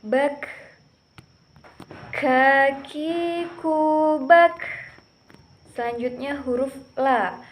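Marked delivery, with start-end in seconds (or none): 2.1-4.6 "Kakiku" stretched very slowly and higher, then "bak" popped loud; teacher's slow stretched letter demo; drawn-out demo. Shown as a demonstration. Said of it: "Ada udara, e, yang mengalir".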